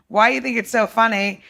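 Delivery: usual speaking voice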